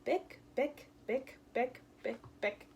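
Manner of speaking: running heels, bick bick, fading off; hurried clicking heels; quick heel clicks. Retold or imitated.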